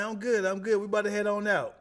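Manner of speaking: bright reply. Retold or imitated. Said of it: "I'm like".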